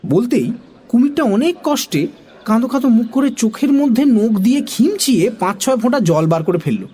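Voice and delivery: ordinary delivery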